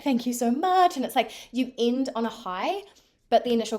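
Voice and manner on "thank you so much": higher, louder gushing thank you; sweet thanks. Retold or imitated. Thanking the clerk at the end, gushing and high. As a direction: lay the gratitude on thick.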